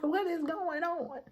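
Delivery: high-pitched, bewildered question; retold loopy bafflement; high on drugs. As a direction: ask it in drugged confusion. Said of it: "I'm all high 'cause, 'cause of the".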